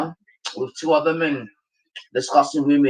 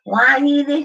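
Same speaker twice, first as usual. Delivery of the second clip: mock whiny woman; 10 semitones higher, 4 dB louder than usual. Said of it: "she's like".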